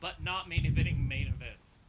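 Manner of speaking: usual speaking voice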